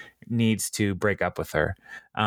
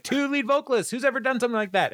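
much higher, quick mock-incredulous cry; incredulous outburst; 14 semitones higher, faster than usual. Singer objects to a second lead vocalist, high incredulous outburst. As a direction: squawk it high in mock disbelief. Acted outburst in retold scene.

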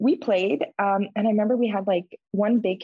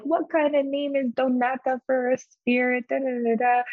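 higher, mocking goofy tone; joking voice; 7 semitones higher than usual. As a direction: make fun of the spirit's name. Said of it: "trying to be funny".

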